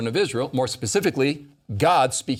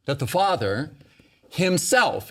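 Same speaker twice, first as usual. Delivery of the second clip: pause, then a firmly punched "himself"; stress on HIMSELF. Real, not acted. Teacher explaining the Father's own love, stressing "himself". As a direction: set "himself" apart after "Father" and land it. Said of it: "I'm emphasizing the word himself".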